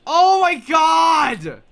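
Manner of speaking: loud, high, long drawn-out cry; exasperated wail. Real, not acted. Player being laughed at by friends, exasperated wail. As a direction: wail it slowly, fed up with the teasing.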